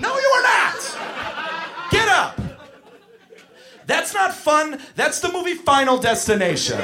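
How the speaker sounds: high, frantic scolding; exasperated yell. Retold or imitated. Counselor to child in skaters' path, exasperated yell. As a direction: shout it like danger is seconds away.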